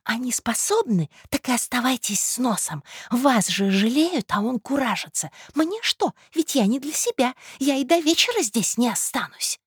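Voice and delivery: high-pitched, indignant scolding; huffy conspiratorial woman. Acted. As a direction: wash your hands of him.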